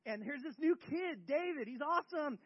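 high whoop into excited cheering; celebrating. Acted. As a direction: cheer like a partying crowd. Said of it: "So now they're partying".

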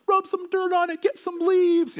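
put-on high yelled kid voices, brushing off the injury; kids shouting. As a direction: boys hollering at a brother with a broken arm, mock high yell. Boys calling out to injured brother.

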